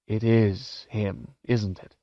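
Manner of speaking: slow, hoarse half-whisper. A narrator's reading. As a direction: let the voice drop, hoarse and slow. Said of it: "his voice dropping to a hoarse whisper".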